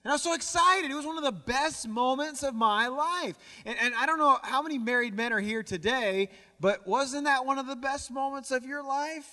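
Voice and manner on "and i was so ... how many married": higher and louder, beaming with joy; excited recall. Real, not acted. Preacher telling the church of his proposal, excited. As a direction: relive the best moment with real excitement.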